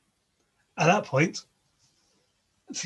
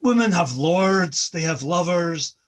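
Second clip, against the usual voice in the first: loud, raised angry retort; 4 semitones higher, 6 dB louder than usual. A narrator's reading. Njord, unable to take more, angrily answering Loki. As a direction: stand and bark back at him.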